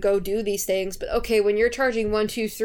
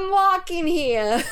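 slow, higher New York tough-guy line; New York accent; 8 semitones higher, slower than usual. Retold or imitated. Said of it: "I love your New York accent".

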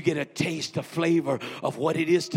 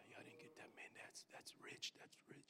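whispered aside; whispering preacher's aside; 31 dB quieter than usual. Real, not acted. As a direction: lean in and whisper to the congregation.

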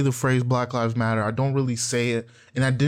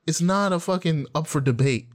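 ordinary talk, nothing marked

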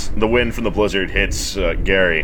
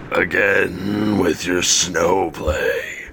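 slow, weary bugbear voice; bugbear Gary; much slower than usual. Acted. Bugbear Gary scolds the kobold, slow weary voice. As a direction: drag it out like a tired old brute. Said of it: "Gary looks to the kobold and says".

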